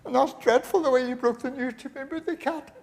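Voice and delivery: high, distressed woman's voice; sick wife scolding her husband, high and upset. Acted. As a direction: wail it like a wife in a hospital bed. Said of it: "She says".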